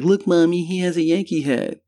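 high-pitched imitation of a child; little kid. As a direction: pipe up like an excited kid telling Mommy. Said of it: "this little kid's like".

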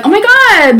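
put-on valley girl. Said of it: "I'm always going".

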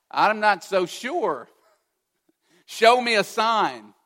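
a hesitant man asking God for proof; doubting Gideon. Acted. Preacher voicing Gideon as doubtful and pleading.

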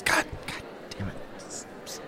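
slow unvoiced groan of a curse; muttered curse. Stanley cursing his failure, slow and whispered. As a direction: breathe the curse out under your breath. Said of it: "Stanley just goes".

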